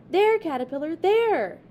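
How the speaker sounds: loud excited pointing call. A narrator's reading. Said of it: "called Chicken".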